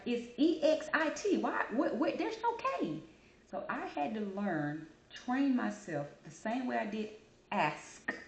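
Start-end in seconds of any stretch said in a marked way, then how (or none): 0.1-3.0 higher, spelling it out in frustration; exasperated self-scold. Retold or imitated. Vlogger reliving her frustration at the slip, exasperated. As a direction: rise in disbelief at yourself. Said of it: "I'm like".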